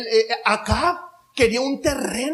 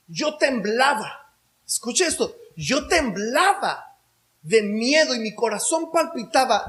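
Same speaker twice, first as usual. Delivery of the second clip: ordinary talk, nothing marked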